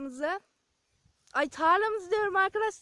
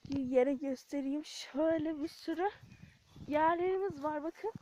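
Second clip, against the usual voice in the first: usual speaking voice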